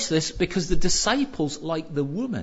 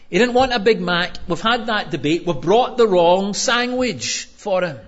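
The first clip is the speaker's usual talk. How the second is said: higher, fast, then louder squabbling; arguing disciple; 4 semitones higher, 5 dB louder than usual. Retold or imitated. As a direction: bicker like a fed-up disciple over lunch. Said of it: "one of them will be saying".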